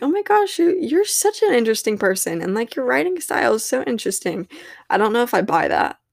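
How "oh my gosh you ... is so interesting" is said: high gushing praise voice; gushing friend. Retold or imitated. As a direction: gush like an excited friend. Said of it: "everybody's like".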